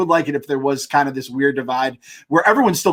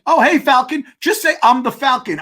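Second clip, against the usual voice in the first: bragging Falcon; 8 semitones higher than usual. Retold or imitated.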